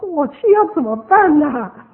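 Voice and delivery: loud, high, drawn-out dismay; helpless exclamation. Retold or imitated. Speaker wondering what to do on arriving, helpless exclamation.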